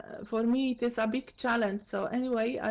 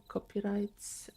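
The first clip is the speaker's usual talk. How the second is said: ordinary talk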